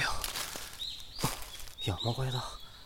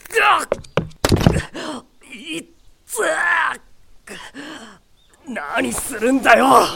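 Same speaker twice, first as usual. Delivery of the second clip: high, loud cry of pain, then slow shouted protest; hurt and furious shout; pained yelling; 10 semitones higher, 5 dB louder, slower than usual. Acted. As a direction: yelp from the stab, then rage. Stabbed with a branch.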